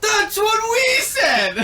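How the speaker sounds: high, slow, laughing yell; laughing shout. Real, not acted. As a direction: yell it out in delight. Table reacting to accent reveal.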